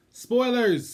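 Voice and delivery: high, loud announcement; excited announcement. Real, not acted. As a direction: proclaim it like a headline. Announcing the spoiler section.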